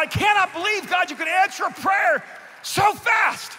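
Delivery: high, loud astonished outburst; overwhelmed. Retold or imitated. Healed musician marvelling at God's answer, overwhelmed shout. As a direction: burst out in amazed awe. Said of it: "I was overwhelmed".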